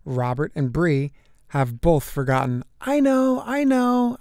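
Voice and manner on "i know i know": high, eager, bursting to answer; hand-up-in-class eagerness; excited schoolkid blurting out the answer. Acted. Eager kid answering teacher.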